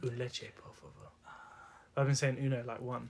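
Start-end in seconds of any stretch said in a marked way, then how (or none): 0.0-0.9 put-on Spanish accent. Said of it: "he says".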